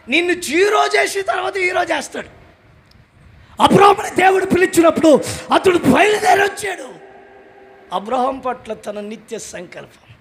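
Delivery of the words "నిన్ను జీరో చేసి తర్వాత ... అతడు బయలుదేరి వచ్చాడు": preaching loudly. Acted. Preaching at full force.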